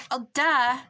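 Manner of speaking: Oh, duh blurted loud and high; real excited shout of an idea; sudden shout. Real, not acted. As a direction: burst out with a realisation. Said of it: "Sorry for screaming".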